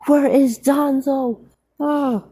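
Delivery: high, slow, loud demand; shouting for Danzo, pitched high and slow. Acted. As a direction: bark out the question, high and drawn out.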